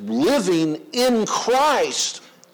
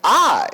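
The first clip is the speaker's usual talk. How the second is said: I punched high and loud; stress on I; 8 semitones higher, 7 dB louder than usual. Shown as a demonstration. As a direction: lean hard on I. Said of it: "put the emphasis on what word?".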